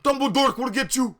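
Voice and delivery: high loud hammed-up shout; loud cartoonish threat. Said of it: "Draco shout".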